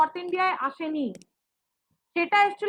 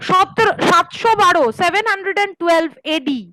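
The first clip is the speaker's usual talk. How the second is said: louder repeat over a bad line; 9 dB louder, much faster than usual. Real, not acted. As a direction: raise the voice so they hear. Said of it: "আরেকবার repeat করুন না".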